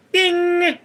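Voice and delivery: high ringing "ding"; boner sound. Shown as a demonstration. Demonstrating the sound for viewers, high ringing ding. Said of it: "the boner sound was".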